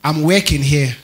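imitated Ghanaian accent, "working" as weking. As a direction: mimic how Ghanaians say it. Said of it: "Is it Ghanaians that say work?".

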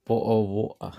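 British accent. Shown as a demonstration. Answering the streamer's imitation with a British accent. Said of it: "he's got a British accent".

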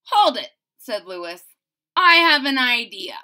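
put-on loud eager kid; eager interruption. Lewis stopping the Tooth Fairy, eager. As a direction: stop her with an idea.

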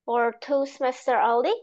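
ordinary talk, nothing marked

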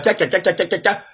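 rapid staccato ca-ca-ca laugh; spelt-out fake laughter; imitated mocking cackle. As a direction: mimic inmates cackling at someone. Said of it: "ils se sont moqués de moi".

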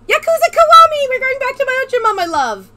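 high, loud gushing squeal; excited fangirl squeal. Pretend. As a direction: squeal it like an obsessed fan. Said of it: "I sound like a creepy fangirl".

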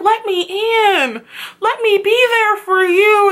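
high, slow mock plea; pleading boyfriend. Imitating Tyler begging his girlfriend, high mock plea. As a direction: beg her to let you in, hammed up. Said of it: "he was like".

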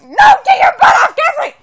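loud laughing yell at sister; shouting. Real, not acted. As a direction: yell it while being pinned. Sister wrestling her off.

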